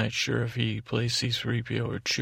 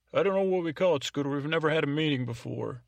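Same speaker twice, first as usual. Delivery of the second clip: interrupting colleague Bob; 4 semitones higher, faster than usual. Acted. Bob's interruption acted out as another voice.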